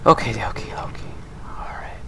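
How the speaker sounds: breathy whispered calming down; soft whispered relief, trailing to nothing; relieved whisper. Real, not acted. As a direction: whisper to yourself to settle your nerves. Catching breath after escape.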